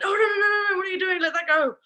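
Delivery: high, loud singsong fussing; urgent playful protest. Acted. Trainer showing how to react when a pup grabs something, high urgent fussing. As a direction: raise it high and loud, as to a puppy.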